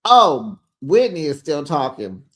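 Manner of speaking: ordinary talk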